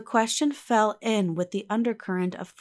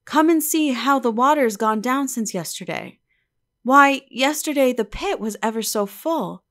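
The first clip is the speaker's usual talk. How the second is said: put-on excited girl; 5 semitones higher, 5 dB louder than usual. A narrator's reading. Eppie's girlish voice.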